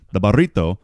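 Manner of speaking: "burrito" with a trilled R, Spanish style; Spanish "burrito" with a rolled R. Shown as a demonstration. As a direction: roll the R in "burrito". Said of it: "when you roll your R's".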